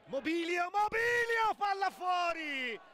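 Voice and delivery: high, loud rising yell; shouting commentary. Real, not acted. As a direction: scream the near miss. Chance missed, shouted call.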